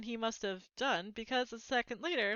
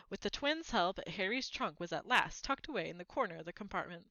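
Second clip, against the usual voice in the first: plain reading voice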